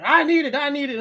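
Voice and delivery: high, loud frantic demand; imitating cracked-out cook. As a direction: whine it like a strung-out cook. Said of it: "He's like".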